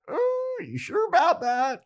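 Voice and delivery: very high, silly squeal; hammed-up high-pitched mock doubt; Spike. Eavesdropper doubting she calmed Willow down, high mock taunt. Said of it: "Spike overhears this. He's like".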